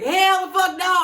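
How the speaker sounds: loud, high, drawn-out mock outrage; outraged refusal. Retelling co-worker rejecting the manager's advance, mock outrage. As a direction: imitate a shouted, stretched-out refusal.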